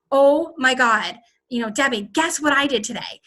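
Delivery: ordinary delivery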